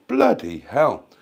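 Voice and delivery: usual speaking voice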